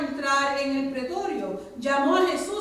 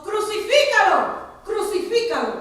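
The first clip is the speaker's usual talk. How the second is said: much higher and louder, a mob's demand; crowd shouting; 8 semitones higher, 9 dB louder than usual. A narrator's reading. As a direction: bellow it twice like an angry crowd. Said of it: "dieron voces diciendo".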